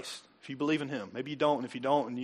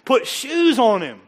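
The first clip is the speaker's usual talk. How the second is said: slow, loud and higher, every word weighted; emphatic command; 8 semitones higher, 11 dB louder, slower than usual. Real, not acted. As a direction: hammer it home slowly.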